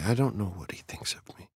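soft unvoiced whisper, half secret; whispered confidence. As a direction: let her whisper it like a shared secret. Confiding secret about psychiatrist.

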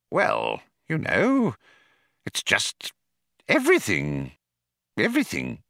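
hesitant, halting, with long pauses; weakly offered. A narrator's reading. Falk answering the computer's question, weak and hesitant. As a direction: grope feebly for an answer. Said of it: "offered Falk weakly".

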